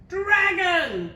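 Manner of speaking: high, loud princess bellow. A narrator's reading. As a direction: bellow it to call the dragon. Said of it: "bellowed the little princess".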